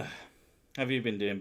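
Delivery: normal talking voice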